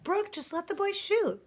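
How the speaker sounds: Crab's voice. Acted. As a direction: push back at the fussy old man. Crab voiced arguing with Grandpop.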